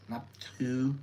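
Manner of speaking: naptu repeated softly in Thai; naptu, quiet careful Thai. Shown as a demonstration. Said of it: "that is to give respect".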